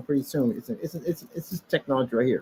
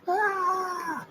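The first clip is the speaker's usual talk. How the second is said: high mock scream of delight; imitating a thrilled high squeal; 3 dB quieter than usual. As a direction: squeal it like a fanboy. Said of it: "Then he'll scream like".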